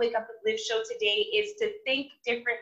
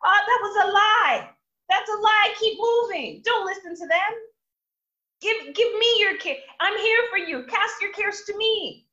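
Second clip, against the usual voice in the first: high, loud, pleading voice of God from above; God exasperated, urging people to move on; voicing God; 7 semitones higher, 4 dB louder than usual. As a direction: call down like a patient heavenly father. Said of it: "God must be sitting from up above like".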